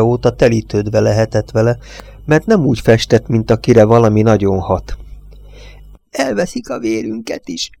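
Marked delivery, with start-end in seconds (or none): 6.1-7.7 put-on high, soft confiding whisper of an old judge; secretive old man, much higher and softer. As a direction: lean in and hush it. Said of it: "suttogta a bíró".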